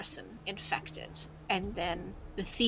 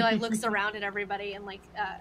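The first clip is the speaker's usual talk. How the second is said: ordinary talk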